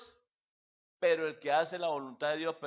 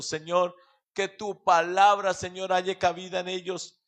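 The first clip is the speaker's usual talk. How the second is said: rising, louder pleading prayer, building in force; fervent prayer; 5 dB louder than usual. Acted. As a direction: pray out for every family listening. Prays for families and listeners.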